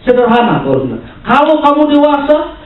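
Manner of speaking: high, insistent sermon voice; forceful preaching. Real, not acted. Preacher telling the church to keep unity, insistent preaching. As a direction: press the point on the congregation.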